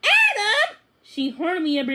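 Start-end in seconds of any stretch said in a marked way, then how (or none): 0.0-0.8 much higher, louder urgent call; shouting his name. A narrator's reading. Lizzie shouts her brother's name in alarm, high and loud.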